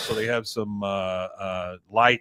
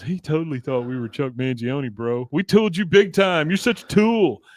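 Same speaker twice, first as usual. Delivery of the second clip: jeering; 3 semitones higher, 4 dB louder than usual. Retold or imitated. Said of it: "Guy goes".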